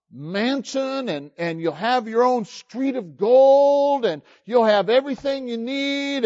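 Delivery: mock a sales pitch for heaven; lightly hammed-up evangelist selling mansions; salesy preacher. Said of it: "and they say".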